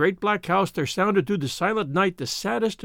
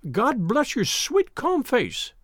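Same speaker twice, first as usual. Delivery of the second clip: hysterical sob; 6 semitones higher, much slower than usual. Acted. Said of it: "she cried with an hysterical sob".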